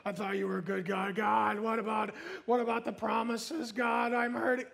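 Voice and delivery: whiny, droning gripe to God; mock whining prayer; grumbling complaint. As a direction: moan it at God like a put-upon complainer. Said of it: "Complaining and grumbling is".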